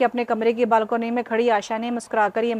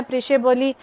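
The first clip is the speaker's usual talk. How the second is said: ordinary delivery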